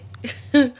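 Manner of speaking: loud high laugh. Real, not acted. Laughs after running over fingers.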